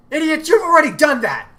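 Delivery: girl mocking the hidden foe's blunder; calling out. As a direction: yell the insult into the dark. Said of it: "Elizabeth called out".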